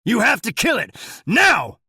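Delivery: very loud, high urgent yelling; shouting. A narrator's reading. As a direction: scream the order down the phone. Sheriff shouting on phone.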